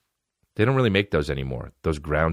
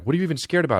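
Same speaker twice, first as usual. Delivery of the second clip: dad asking; 10 semitones higher, much faster than usual. Retold or imitated. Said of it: "my dad would be like".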